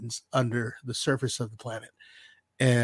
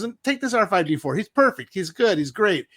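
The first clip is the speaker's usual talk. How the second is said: higher, pushy sales pitch for the droid; salesy mechanic; 7 semitones higher, 6 dB louder than usual. Retold or imitated. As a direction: oversell the droid like a used car dealer. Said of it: "She goes".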